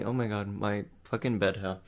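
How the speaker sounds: ordinary delivery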